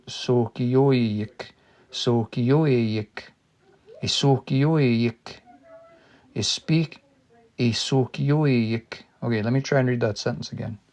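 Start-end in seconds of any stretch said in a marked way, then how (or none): 0.1-9.0 sokiyoik drawn out syllable by syllable, slow; slow practice. Shown as a demonstration. Learner drilling a hard Cree word, slow and careful. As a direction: sound out sokiyoik slowly, drilling it. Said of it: "Let me practice this one a few times".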